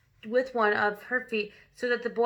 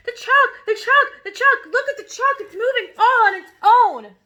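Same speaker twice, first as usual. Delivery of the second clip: shrill, much higher panicked scream; 11 semitones higher, 11 dB louder than usual. A narrator's reading. Boy screaming at the moving chalk, shrill and loud. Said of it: "and screaming".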